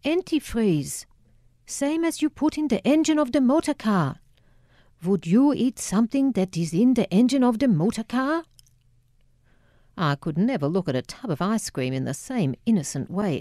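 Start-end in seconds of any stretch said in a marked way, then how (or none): 0.0-8.4 put-on sneering Dutch accent. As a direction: hammer the warning in a Dutch accent. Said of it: "he sneered in his guttural Dutch accent".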